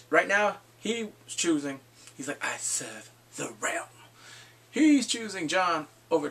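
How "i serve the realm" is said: low, soft, slow imitation; mock-grave delivery, spaced out and hushed; solemn counselor. Acting out Varys's solemn reply.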